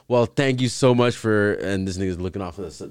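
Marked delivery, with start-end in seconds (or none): 0.1-1.5 higher, slow, gracious interviewer imitation; imitated over-polite reporter; mock interviewer. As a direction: wrap up politely as a red-carpet host. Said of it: "And he said".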